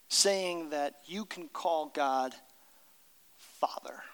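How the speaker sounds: drops to a near whisper on Father; hushed reveal. Acted. Pastor revealing Jesus' shocking word, hushed. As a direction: breathe the word Father like a secret. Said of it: "dramatic hushed reveal of Father".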